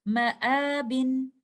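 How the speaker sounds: Ma'abin recited with the alif mad held two counts; Ma'abin drawn out for two counts; recited Quranic word. Shown as a demonstration. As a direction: chant Ma'abin in tajweed style, stretching the mad. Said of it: "we will recite it like this".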